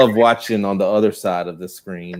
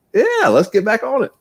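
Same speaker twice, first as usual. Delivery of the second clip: pumped-up quote; 14 semitones higher than usual. Retold or imitated. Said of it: "you're like".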